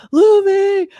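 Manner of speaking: high, loud dramatic call of a name; hammed-up anguished shout; emotional cry. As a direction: cry out as in a big rescue scene. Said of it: "this big emotional scene".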